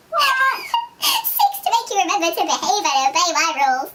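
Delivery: reading through laugh-crying. Real, not acted. Reader breaking down laughing at the whip line. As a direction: crack up but keep reading.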